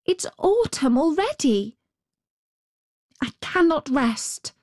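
put-on high little girl's voice, dismayed; Gerda. A narrator's reading. Little girl scolds herself for lateness, high and dismayed. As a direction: lift it high like a young girl fretting. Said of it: "said Gerda".

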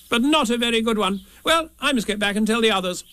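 high-pitched, dismissive rabbit voice; brisk rabbit. A narrator's reading. Rabbit leaving to tell the others, brisk.

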